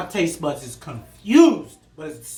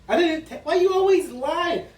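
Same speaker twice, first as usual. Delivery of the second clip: indignant denial; 9 semitones higher than usual. Real, not acted. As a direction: deny it in shock. Accused of tasting early.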